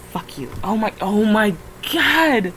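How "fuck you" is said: muttered under breath. Real, not acted.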